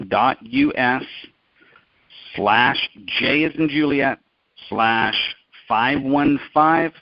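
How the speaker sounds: slow dictation with long pauses between chunks; slowly. Real, not acted. Host repeating the web address for listeners to copy, slowly. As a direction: spell it out slowly, piece by piece. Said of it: "repeat it slowly".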